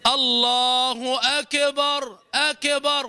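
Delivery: "أكبر" as "akebar", echo vowel after K; bounced K. Shown as a demonstration. Teacher imitating a widespread error, bounced K. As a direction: bounce the ك of أكبر in a drawn-out call. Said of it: "يقلقل الكاف فيقول".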